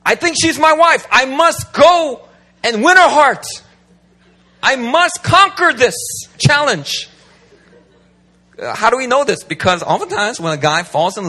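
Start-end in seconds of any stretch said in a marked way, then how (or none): 0.0-7.1 high, loud, slow grandiose conqueror; mock heroic conquering declaration; conquering man voice. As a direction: ham up a knight off to win his bride, slow and loud. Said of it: "all men naturally have a conquering mentality".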